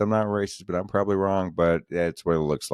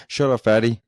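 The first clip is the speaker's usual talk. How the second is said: a higher, louder mock snap of shut up, fatty; imitating friend's retort; 6 semitones higher, 7 dB louder than usual. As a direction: bark it back like an annoyed gamer friend. Friend snapping back in game chat.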